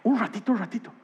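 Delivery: awed hush. Real, not acted. Preacher recounting his own revelation, hushed and awed. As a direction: whisper it in wonder.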